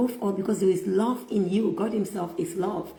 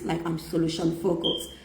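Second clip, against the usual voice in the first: ordinary talk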